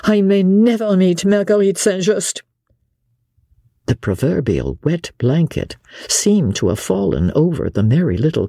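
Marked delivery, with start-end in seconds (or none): 0.0-2.4 higher-pitched, even-toned figure in dialogue; earnest character voice. A narrator's reading. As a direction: voice a character in a high, level tone.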